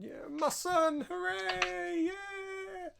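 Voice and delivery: very high, slow, gleeful cheer; mock cheering. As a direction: squeal it in mock joy. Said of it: "And then".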